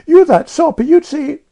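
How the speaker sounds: put-on very high, loud child's dare; dogged challenge. A narrator's reading. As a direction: challenge her stubbornly. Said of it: "challenged Jane doggedly".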